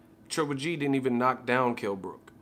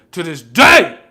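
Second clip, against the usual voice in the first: yelled, much higher and louder; sudden yell; 5 semitones higher, 19 dB louder, slower than usual. Real, not acted. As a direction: blow up on the repeat. Repeats phrase, second one exploding.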